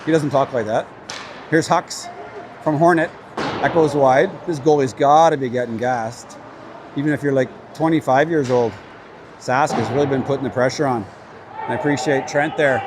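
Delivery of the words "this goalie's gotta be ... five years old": usual speaking voice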